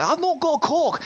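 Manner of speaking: high-pitched, hammed-up Glaswegian; bad Glaswegian accent. Interviewer mimicking the guest, bad Glaswegian accent. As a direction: put on a terrible Glaswegian accent. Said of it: "that's the worst Glaswegian accent".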